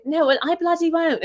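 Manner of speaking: lightly mocked British accent, pitched high; a posh British woman refusing. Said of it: "she really had, like, the deep accent".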